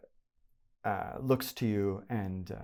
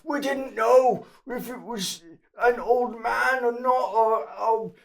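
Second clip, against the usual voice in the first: very high, loud panicked squeal; terrified lowlife yelping for mercy; cornered thug begging; 11 dB louder than usual. Acted. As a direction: stammer and plead, voice way up.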